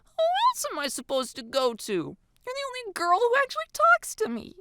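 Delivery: put-on high, sulky girlish whine; pouting. A narrator's reading. Girl to her only friend, pouting. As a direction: pout it in a high voice. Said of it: "kicks at the floor and pouts".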